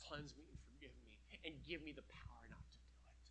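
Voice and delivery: whispered plea for forgiveness; hushed prayer. Acted. Praying man begging God's forgiveness, in a soft whisper.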